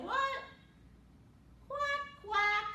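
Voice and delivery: loud, high storybook quacks; duck quack. A narrator's reading. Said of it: "ducky said".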